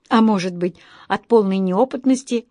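plain reading voice

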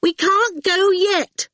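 put-on slow, very high, loud child's protest; insisting loudly. A narrator's reading. Awful refuses to leave, loudly insistent. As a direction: insist loudly, stretching the words. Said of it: "Awful insisted loudly".

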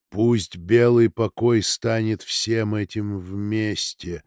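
very slow, weighted spell words; slow incantation. Acted. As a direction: cast it slowly like a spell.

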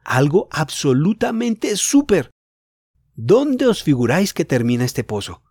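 put-on boy bursting with news; thrilled. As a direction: draw out the excitement.

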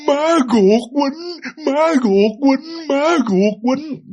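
put-on very slow and high, each word forced out by a boy who can barely speak; labored child forcing out words; strained halting words. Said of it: "ông cứ nói ba chữ đó".

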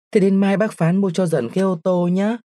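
put-on high-pitched, eager child's request; excited child calling. A narrator's reading. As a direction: call back happily from the doorway, pitched well up. Child calls back from the door. (giọng trẻ con hào hứng, gọi với lại cao vút)

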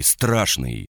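ordinary delivery, nothing marked

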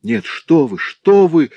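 high, loud, alarmed protest; frightened. A narrator's reading. Servant girl hastily backs down before threatening master, frightened. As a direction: blurt it out in fright. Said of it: "в испуге сказала я ему".

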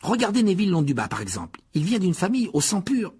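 very high, strained, outraged youngster; indignant boy. A narrator's reading. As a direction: explain a vile insult in a high, worked-up voice. Voices indignant sick boy Ron. (garçon indigné, voix très aiguë)

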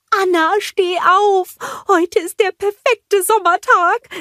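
high, loud excited snowman; Olaf. A narrator's reading. Snowman waking the princess, high excited call. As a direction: squeal it out with eager energy. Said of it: "rief er aufgeregt".

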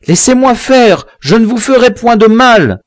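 tense man forcing himself to kill; frantic killer. A narrator's reading. The mixed-blood man answers his victim, high and frantic.

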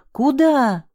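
high-pitched alarmed yell. A narrator's reading. Man yells at her as she runs into gunfire. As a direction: yell it sharply, pitch leaping up. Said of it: "заорал Саша".

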